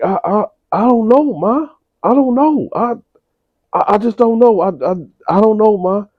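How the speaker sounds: mock a cornered son; panicked son. Son stammering to mother.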